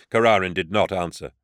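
ordinary delivery

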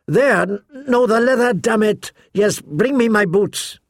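high, hurried orders; impatient bark. A narrator's reading. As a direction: snap out orders while dressing in haste. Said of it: "he barked at Shay".

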